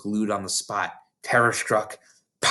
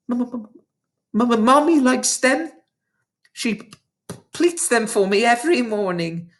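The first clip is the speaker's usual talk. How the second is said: put-on high, stammering little girl; a terrified girl stammering to the headmistress; frightened sputter; 11 semitones higher than usual. A narrator's reading. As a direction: stutter it out, scared stiff. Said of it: "managed to sputter".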